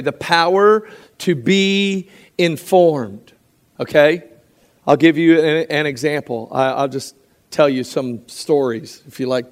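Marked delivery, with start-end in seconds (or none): 0.0-3.3 drawn out, higher and slower; slow emphatic definition. Shown as a demonstration. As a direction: lay the definition down slowly. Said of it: "I describe that this way".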